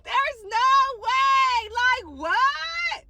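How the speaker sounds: high, slow, laughing out the words; laughing disbelief. Real, not acted. Narrator still laughing at the man's take, slow and high. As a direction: stretch it out while still laughing.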